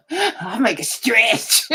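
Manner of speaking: slow mock quote through laughter; mock her excuse while laughing. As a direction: drag out her words while cracking up. Mock-quoting the caller again.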